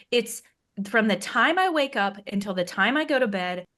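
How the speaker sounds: usual speaking voice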